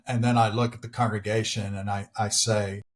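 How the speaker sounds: usual speaking voice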